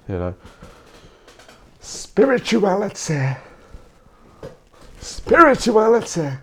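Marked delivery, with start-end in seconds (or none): 1.8-6.3 high, drawn-out mystic voice; mock mystic. Vlogger mocking spirituality to viewers, hammed-up lofty voice. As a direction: sing it like a spiritual guru.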